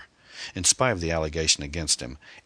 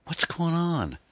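put-on a much higher girl's voice, puzzled; teen girl voice; 13 semitones higher, slower than usual. Amy walks in on the scene, high puzzled girl voice. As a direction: voice the teenage daughter, high and bewildered.

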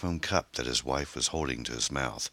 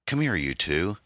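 mild call, slightly raised voice; 5 semitones higher, 4 dB louder than usual. A narrator's reading. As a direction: call the children over, a little higher. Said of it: "he called".

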